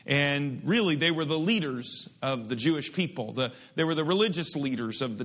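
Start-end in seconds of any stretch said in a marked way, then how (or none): none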